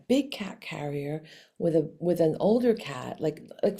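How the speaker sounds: ordinary delivery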